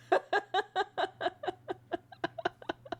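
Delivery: long, high, helpless laughing fit; real laughter. Real, not acted. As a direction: laugh until you can't talk. Player cracks up at the table.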